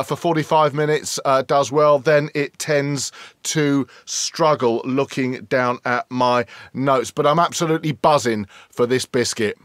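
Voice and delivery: ordinary talk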